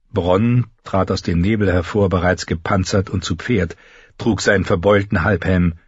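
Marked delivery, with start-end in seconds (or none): none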